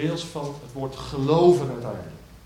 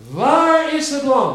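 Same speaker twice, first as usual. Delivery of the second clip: slow ringing question; 11 semitones higher, 12 dB louder, slower than usual. Shown as a demonstration. Preacher to congregation, posing Isaac's question, slow and loud. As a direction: call out slow and high, WAAR stretched and loud. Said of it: "En hoe luidt die vraag?".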